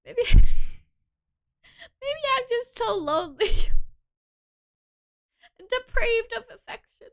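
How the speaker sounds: giggling lonely confession; laughing through it. Real, not acted.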